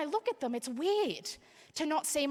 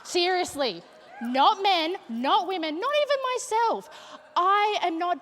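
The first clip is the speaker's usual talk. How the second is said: emphatic; 8 semitones higher, 6 dB louder than usual. Real, not acted. Emphatic comic rant.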